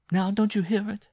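high, loud, alarmed cry; frightened cry. Acted. Jean to Simon hearing the intruder, frightened loud cry. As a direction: cry out in fear. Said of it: "she cried with an inarticulate gasp".

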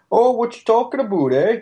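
high mock French Canadian twang; mock French Canadian accent. Mocking Canadians in a hammed-up French Canadian accent. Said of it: "They speak with that heavy French accent".

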